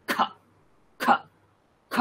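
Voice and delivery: খ with a strong puff of breath; breathy aspirated খ from deep inside; aspirated kha. Shown as a demonstration. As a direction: push extra breath through খ. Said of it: "একটা ভিতর থেকে কষ্ট হবে উচ্চারণ করতে".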